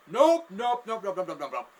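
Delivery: loud high burst of nopes, tailing down; mock outcry, starting loud and easing off; alarmed refusal. Onlookers refusing a man's crude last trick, alarmed refusal.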